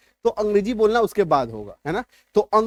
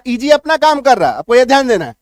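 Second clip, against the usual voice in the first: emphatic teaching; 3 semitones higher, 7 dB louder than usual. Real, not acted. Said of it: "teacher emphasis".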